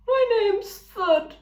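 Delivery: friendly eager ram; Thud the ram. A narrator's reading. Ram introducing himself to the robot, eager. Ram's voice.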